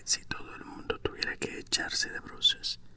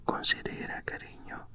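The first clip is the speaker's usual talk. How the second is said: ordinary delivery, nothing marked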